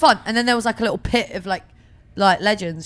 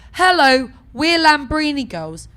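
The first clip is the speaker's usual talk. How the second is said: higher, louder greeting, slowing on the band name; retold chirpy introduction; 5 semitones higher, 7 dB louder, slower than usual. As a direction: announce the band as if just arriving. Acting out band introducing itself.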